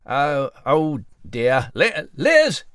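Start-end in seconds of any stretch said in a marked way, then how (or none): 1.7-2.6 calling out. Acted. Calling for missing cat.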